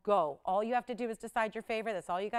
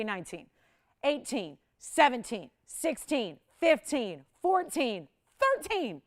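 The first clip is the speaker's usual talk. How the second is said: building price countdown; 5 dB louder, slower than usual. Real, not acted. Host drops the price for viewers, excitement building.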